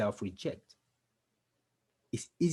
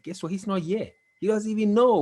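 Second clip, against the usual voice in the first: defiant outburst; 9 semitones higher, 4 dB louder than usual. Real, not acted. Defiant about absent father.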